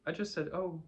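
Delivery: high-pitched, nervous reply. A narrator's reading. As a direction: pipe up nervously in self-defence. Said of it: "said Piglet nervously".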